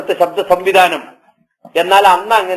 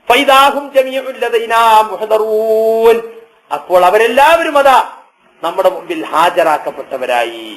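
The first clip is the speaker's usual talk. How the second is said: slow, raised sermon chant; Quran recitation; 3 semitones higher than usual. Acted. Preacher recites the single blast to listeners, chanting. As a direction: chant it out with long-held endings. Recites verse, intones its translation.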